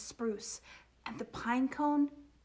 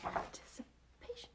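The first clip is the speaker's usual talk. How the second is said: hushed whispered aside; teasing, barely audible storyteller whisper; suspenseful whisper; 11 semitones higher, 12 dB quieter than usual. A narrator's reading. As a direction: whisper it into the pause. Teasing the pause before the plop.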